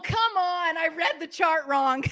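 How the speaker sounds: ordinary talk, nothing marked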